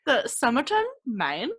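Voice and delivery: playful attempt at an Australian accent on the name. Podcast host tries the name in an Australian accent. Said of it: "I'm gonna try my best Australian accent".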